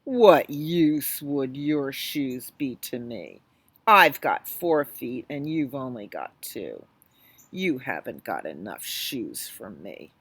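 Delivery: low, slow tiger voice. Acted. Tiger scoffing at the boy's shoes, low and slow. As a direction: rumble it out, unimpressed.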